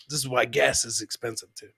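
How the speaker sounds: usual speaking voice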